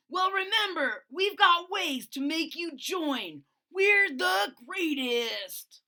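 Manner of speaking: high, loud, slow bragging threat; menacing bully. Acted. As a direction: draw out the threat like a smug bully. Bully dinosaur Tyrone voiced.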